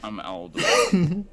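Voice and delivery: loud and slow, flat announcement; loud, slow deadpan self-introduction; blunt intro. Acted. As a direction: bark your name out slowly. Abrupt in-character introduction.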